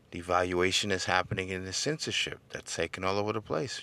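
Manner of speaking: ordinary talk, nothing marked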